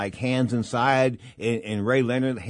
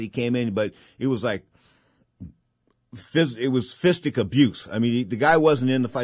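normal talking voice

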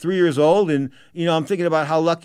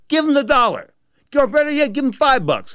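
high, loud exhortation; forceful urging; 10 semitones higher, 5 dB louder than usual. Real, not acted. Host urging listeners to give to the homeless, forceful. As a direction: push it out loud and insistent.

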